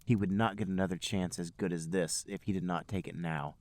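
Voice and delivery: ordinary talk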